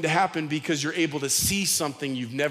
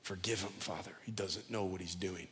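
whispered prayer; 9 semitones lower, 14 dB quieter than usual. Retold or imitated. Jesus praying, almost whispered.

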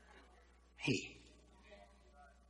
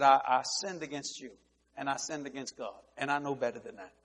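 softer, solemn repentance; humble confession; 3 semitones lower, 7 dB quieter than usual. Retold or imitated. Boss confessing to his staff, soft and solemn. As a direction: confess quietly and earnestly.

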